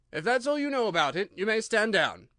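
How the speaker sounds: a king scolding his subject; loud reproving royal voice; stern king. A narrator's reading.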